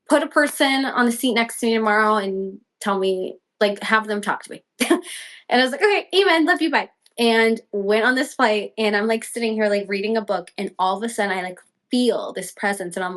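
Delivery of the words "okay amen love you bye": high, breezy retold goodbye; light mock-casual farewell, voice lifted; breezy sign-off. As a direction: toss off a cheery prayer sign-off, pitched high.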